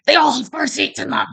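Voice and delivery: high, loud, indignant shouting; heightened outburst. Retold or imitated. New mother shouting at a nurse about car seats, indignant. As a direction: fire it back hard, feeling judged. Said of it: "a really heightened state".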